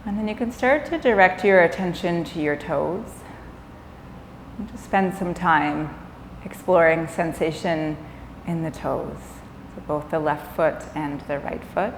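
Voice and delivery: calm yoga nidra guidance with long pauses; guided meditation. Acted. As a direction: ease them gently into rest, leaving space between phrases.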